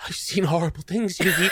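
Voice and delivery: high put-on voice of a shaken victim; hammed-up haunted survivor, pitched high; traumatized character. Noah's popped-out head speaking to Yugi, mock traumatized. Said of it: "and it's just like".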